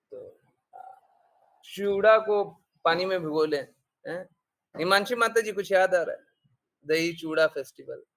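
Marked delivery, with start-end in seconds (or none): 4.8-5.5 calling out her name, higher. Real, not acted. Teacher calling on a student.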